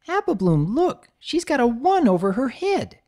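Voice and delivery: high, excited squeaky call; excited squeak. Acted. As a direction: squeal it out pointing. Sweetie excited pointing.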